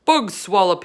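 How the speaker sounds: loud dismissive boom. A narrator's reading. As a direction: boom it out in disgust. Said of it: "he boomed".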